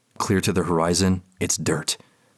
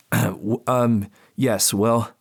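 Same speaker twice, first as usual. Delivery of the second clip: halting, flustered stammer; 5 semitones higher, much slower than usual. Acted. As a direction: trip over the first words. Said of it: "I stammer".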